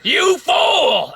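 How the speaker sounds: high, slow, squawking comedian impression; Gilbert Gottfried. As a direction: stretch it out in a strained screech. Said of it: "Gilbert Gottfried did a Jesus movie".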